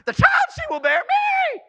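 very high, loud squealed realisation; astonished shriek. Acted. Preacher voicing Elizabeth suddenly realising about Mary, astonished shriek. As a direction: build to a shriek on the name.

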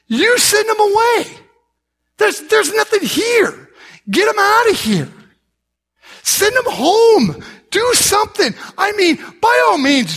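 high-pitched, exasperated protest; exasperated disciples. Retold or imitated. Disciples urging Jesus to send the crowd home, exasperated.

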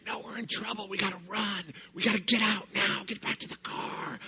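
put-on high, hushed kid panic; panicky kid voice. Storyteller imitating kids begging to run away, high and hushed. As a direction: play a frightened kid urging escape.

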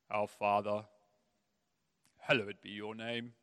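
mock solemn recitation; rote prayer. Parent reciting a scripted prayer, stiff.

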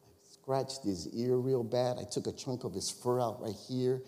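ordinary delivery

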